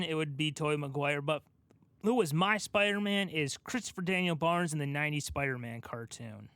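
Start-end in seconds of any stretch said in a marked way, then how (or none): none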